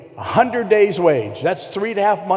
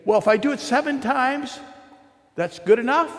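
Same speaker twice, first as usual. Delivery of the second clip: Peter's question pitched much higher; voicing Peter; 8 semitones higher than usual. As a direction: play Peter, eager and hopeful, up high. Preacher voices Peter asking.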